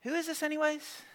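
high, hushed aside; puzzled churchgoer. Retold or imitated. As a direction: ask it small and high under the cheering. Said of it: "And we're like".

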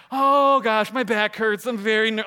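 suddenly scared at the top; panicked whine. Retold or imitated. Preacher at the zipline, suddenly terrified, strained whine. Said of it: "I'm like".

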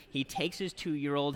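ordinary talk, nothing marked

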